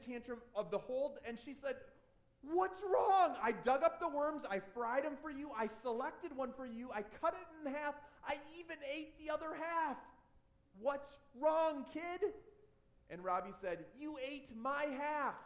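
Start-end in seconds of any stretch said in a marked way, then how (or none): 2.4-12.3 high, loud and fed up; pleading with a screaming kid; the neighbor lady losing patience with Robbie, loud and high; exasperated neighbor. Retold or imitated. 13.9-15.4 put-on sulky child. Robbie's punchline in story.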